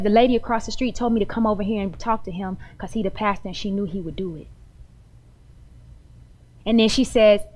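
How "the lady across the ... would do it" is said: quiet hurried plea. Retold or imitated. Voicing the sister in need.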